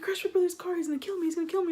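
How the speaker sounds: high, fretful mock panic; imitated panic. Storyteller plays friend dreading her brother, high mock panic.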